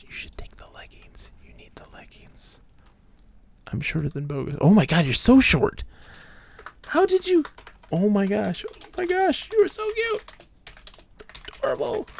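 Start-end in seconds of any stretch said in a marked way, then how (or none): 0.0-2.6 breathy whisper, much softer; hushed whisper urging her; whispered advice. Acted. Reading game character's advice aloud. 6.9-12.1 squeaky high coo; cutesy cooing. Real, not acted. Cooing at a tiny game character.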